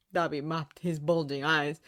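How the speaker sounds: ordinary delivery, nothing marked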